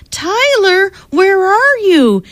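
put-on high, drawn-out call for a child; slow, searching storybook call without shouting; mom calling out. A narrator's reading. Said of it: "his mom called from the dining room".

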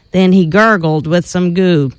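playful storybook narration with spooky sound words; reading to children. Acted. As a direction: read it like a bedtime story for kids. Reads Halloween picture book aloud.

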